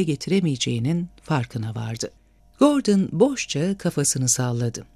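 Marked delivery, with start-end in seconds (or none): none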